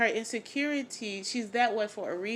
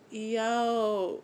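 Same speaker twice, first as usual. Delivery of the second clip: usual speaking voice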